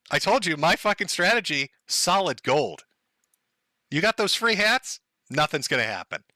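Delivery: ordinary talk, nothing marked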